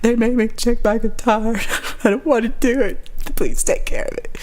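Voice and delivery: high tearful pleading; imitating a weepy, helpless plea; mock crying. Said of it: "I'm crying".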